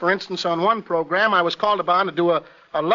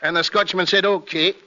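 comic Scotchman's accent; Scottish accent. Shown as a demonstration. Actor telling a dentist joke, Scottish accent. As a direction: tell a joke in Scots burr. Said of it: "I can play the Scotchman".

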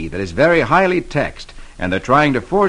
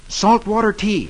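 put-on boy voice; 8 semitones higher than usual. Boy gives password.

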